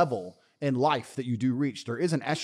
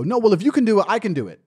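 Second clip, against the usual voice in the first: higher, louder mimicked retort; mock protest; 7 semitones higher, 6 dB louder than usual. Mimicking women who insist they can do it, mock protest. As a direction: imitate someone insisting on equal treatment. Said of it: "they'll sit there and go".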